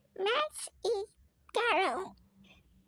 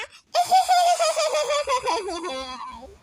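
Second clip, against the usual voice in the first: loud, high cackling shriek of laughter; helpless loud laughing fit; 4 semitones higher, 6 dB louder than usual. Real, not acted. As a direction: burst out in screaming laughter.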